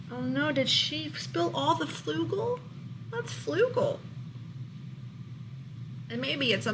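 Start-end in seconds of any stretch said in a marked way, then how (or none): none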